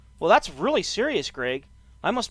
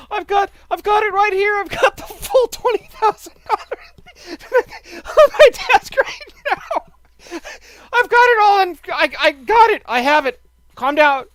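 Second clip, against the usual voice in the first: high and loud, giggling through the words; barely holding it together; laughing; 11 semitones higher, 8 dB louder than usual. Real, not acted. Bluffing the tax man about the money, laughing loudly.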